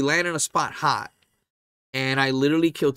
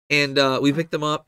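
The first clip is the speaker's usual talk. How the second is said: ordinary talk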